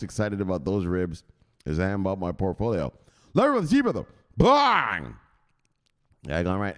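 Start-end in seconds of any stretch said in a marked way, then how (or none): 3.3-5.1 high, loud catchphrase yell; hype shout. Pretend. Introducing his guest with a catchphrase, loud high shout. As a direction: shout it like a big entrance.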